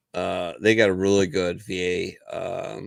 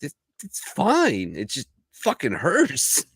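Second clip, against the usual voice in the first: higher, whining soldier, slowing on the complaint; whiny soldier; 6 semitones higher, slower than usual. Retold or imitated. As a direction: play it up as a hurting private, a bit hammed. Whiny soldier told to medic.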